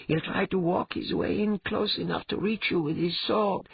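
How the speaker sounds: high-pitched female cat character, warning; worried cat companion. Acted.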